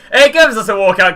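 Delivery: a real burst of pack-opening excitement; excited. Real, not acted. Walkout appears in pack.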